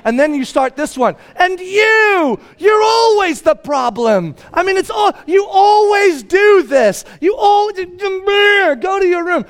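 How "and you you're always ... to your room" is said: high, strained full-volume tirade; furious dad shouting. Retold or imitated. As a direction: yell in exasperation at the kids. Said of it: "But now I'm mad now".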